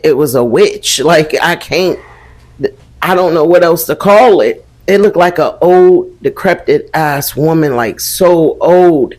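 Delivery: ordinary talk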